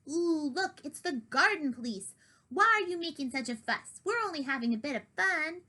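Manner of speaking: mocking plump cat. Duke taunting Kitty from the tree, sly and teasing. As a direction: taunt the garden police.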